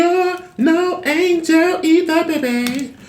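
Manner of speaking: high, slow diva voice sliding up; sing-song it like a pop diva teasing; drawn-out high playful diva impression.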